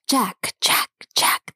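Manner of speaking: soft hiss close to the ear. A narrator's reading. Spirit hissing softly into the narrator's ear. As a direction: breathe the name right into his ear. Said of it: "the voice hissed softly right into my ear".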